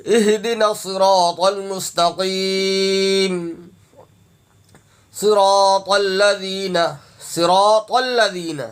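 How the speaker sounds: slow, drawn-out tajweed recitation; Quran recitation. Shown as a demonstration. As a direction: recite slowly with long held vowels. Recites verses slowly. (ٹھہر ٹھہر کر تجوید سے تلاوت)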